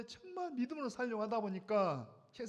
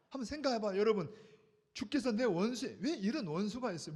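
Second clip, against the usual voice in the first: usual speaking voice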